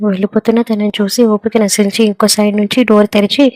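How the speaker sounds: ordinary delivery, nothing marked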